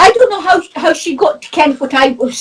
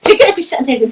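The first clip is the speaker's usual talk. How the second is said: ordinary talk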